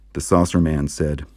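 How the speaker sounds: ordinary delivery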